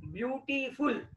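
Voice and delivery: ब्यूटीफुल slowly and softly split up; broken into syllables. Shown as a demonstration. Teacher showing students syllable breaks, ब्यूटीफुल slowly in separate pieces. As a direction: sound out ब्यूटीफुल as byu-ti-ful, slowly. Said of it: "देखो ये टूटा".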